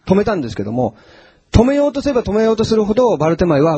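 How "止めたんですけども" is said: usual speaking voice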